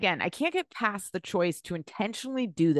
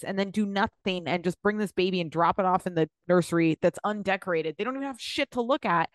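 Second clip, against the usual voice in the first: ordinary talk, nothing marked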